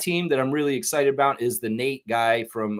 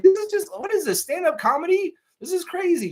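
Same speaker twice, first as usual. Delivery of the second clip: amazed. Real, not acted. Stunned reaction to the story.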